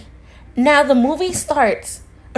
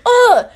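loud, high-pitched "ugh"; exasperated groan; 9 semitones higher, 5 dB louder than usual. Retold or imitated. Fed up with driving him home, exasperated groan.

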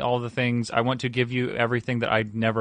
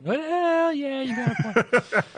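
laughing mimic; 14 semitones higher, much slower than usual. Echoes with a laugh.

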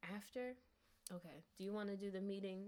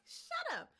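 much higher, laughing mock voice; laughing mock wife. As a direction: squeal it through laughter. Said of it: "And she like".

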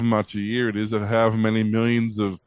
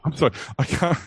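breaking character giggling; 4 semitones higher than usual. Real, not acted. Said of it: "I can't do it with a straight face".

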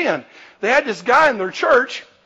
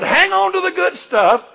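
exhortation; 10 semitones higher, 3 dB louder than usual. Real, not acted. Preacher exhorting congregation.

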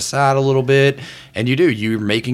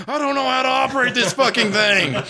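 high, strained panicked yell; panicked man yelling; 13 semitones higher than usual. Acted. Forklift driver crashing through the store, panicked high yell. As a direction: shout it like a man losing control of a forklift. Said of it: "and this man yell".

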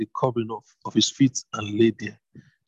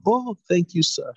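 put-on higher, eager young woman's thanks; breathless grateful young woman; grateful Ruth; 8 semitones higher than usual. A narrator's reading. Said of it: "she replied".

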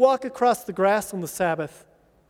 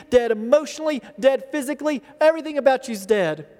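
ordinary talk, nothing marked

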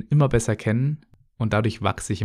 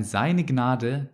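usual speaking voice

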